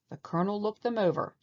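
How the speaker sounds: plain reading voice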